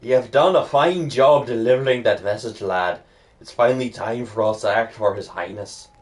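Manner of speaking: lightly voiced Scottish mentor with a bad accent; terrible Scottish accent. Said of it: "There's my terrible Scottish accent".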